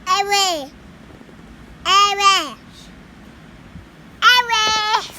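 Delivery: calling out. Real, not acted. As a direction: call the word out. Child calling out repeatedly.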